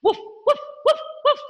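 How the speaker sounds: high yapping woofs; dog bark. Shown as a demonstration. Teacher barks like a dog for the children. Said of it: "like a dog".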